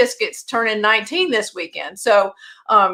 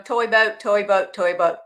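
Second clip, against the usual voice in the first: tongue twister try; 2 semitones lower, 4 dB quieter than usual. Shown as a demonstration. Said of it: "I say it's".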